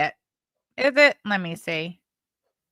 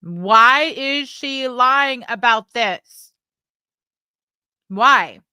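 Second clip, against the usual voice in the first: loud, slow, drawn-out demand; indignant outcry; 4 semitones higher, 6 dB louder, much slower than usual. Real, not acted. Calling out a liar to her chat, loud and slow. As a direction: demand an answer, loudly. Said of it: "I'm not gonna let that rest".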